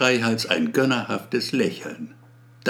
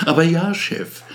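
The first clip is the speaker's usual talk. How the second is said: eager reply; 6 semitones higher, 3 dB louder than usual. Acted. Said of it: "mit hochrotem Kopf".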